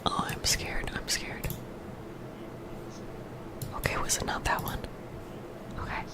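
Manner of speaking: soft close whisper; ASMR whisper. Real, not acted. Streamer whispering through earbud trouble to chat.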